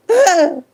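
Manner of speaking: a sudden loud high laugh; loud cackle. Real, not acted. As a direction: burst out laughing. Laughs loudly.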